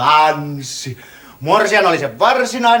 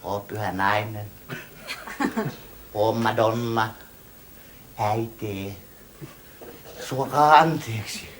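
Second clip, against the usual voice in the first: low, soft and tender to his mother; softly begging the mother's pardon, lower; humble apology; 9 semitones lower, 7 dB quieter than usual. Acted. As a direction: lower your voice for the holy mother. Son humbly addresses his mother.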